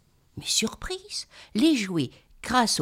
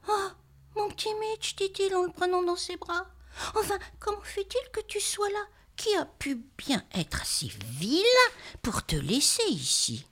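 tender; 9 semitones higher, 4 dB quieter than usual. Acted. Boy tenderly holds his bear.

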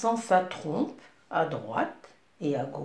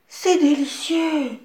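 high, loud cry of delight; delighted exclamation; 9 semitones higher, 7 dB louder than usual. Acted. Princess tasting the cucumber, high and delighted. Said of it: "s'exclama la princesse".

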